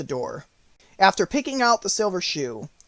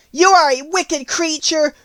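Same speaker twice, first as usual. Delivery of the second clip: put-on high, loud accusing girl; angry little girl, voice raised; indignant cry; 12 semitones higher, 9 dB louder than usual. A narrator's reading. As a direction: cry out in protest as a wronged child. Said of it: "cried Dorothy".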